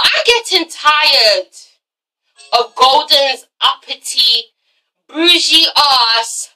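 words spaced out, slow and deliberate; slow, pointed complaint. Real, not acted. Vlogger ranting about Golden, slow and deliberate. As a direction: drag each phrase out in irritation. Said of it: "slow emphatic complaint".

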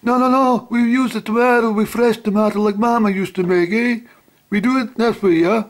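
high, loud hammed-up Italian-American gangster accent; mafia voice. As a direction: play the mafioso insisting on Mama's way. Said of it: "not doing my best mafia voice".

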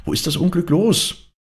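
high-pitched excited maid's question; urgent calling out. A narrator's reading. Maids press Angrete for the news, high excited call. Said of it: "riefen die Dirnen wieder".

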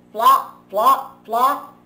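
loud, punchy "flop" each time; sound effect words. A narrator's reading.

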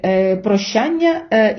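normal talking voice

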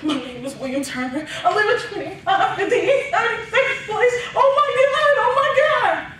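high, rising panic through laughter; little brother after hitting his head, reciting his address in high panic; panicked kid. Retold or imitated. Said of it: "He gets up, he goes".

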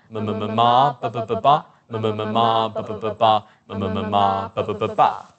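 rhythmic ma-ba vocal warm-up; lip articulation drill. Shown as a demonstration. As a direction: roll through the groups evenly without a break. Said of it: "exercise the tongue and lips".

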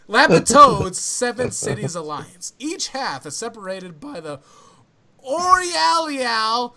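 loud, drawn-out flamboyant reading. Pretend. Struggling through fantasy names for friends, mock flamboyant. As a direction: ham it up, stretching the strange names grandly. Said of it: "flamboyant voice".

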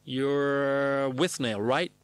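very long drawn-out first word; slow reply. Acted. Spielberg slowly recognising the actor, drawn out. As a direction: stretch it out while placing the face. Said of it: "Surf his pause till he replies".

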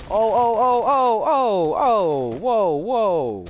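high, loud string of oh's; excited yelling. Real, not acted. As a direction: yell in rising disbelief. Reacting to wild game moment.

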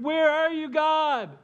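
high, loud cry to God; desperate cry. Acted. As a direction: call out in anguish. Voicing people crying out.

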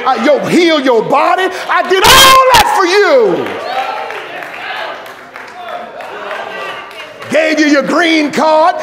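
loud, high whooping preacher in full flight; fiery revival sermon climax; preaching at full force. Acted. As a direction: shout it to the whole congregation.

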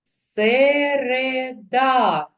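"Середа" very slowly, every vowel held long and high; almost chanted "Се-ре-да" with long vowels; drawn-out vowels. Shown as a demonstration. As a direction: read "Середа" as stretched-out syllables. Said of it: "протягуючи голосні звуки".

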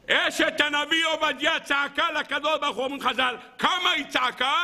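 high, sustained declamation; impassioned preaching. Real, not acted. Rabbi asking listeners about the prophet's wife, declaiming high.

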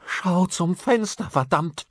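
loud, high, slow urgent cry; horrified. A narrator's reading. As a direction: urge him in horror, stretching the words. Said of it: "In ihrem Ton war echtes Entsetzen". (entsetzt, laut und hoch drängend)

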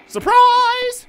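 high, loud scare yell; shrill surprise shout. Acted.

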